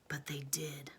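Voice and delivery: soft whisper. Real, not acted. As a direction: hush it like a secret. Whispered storytelling.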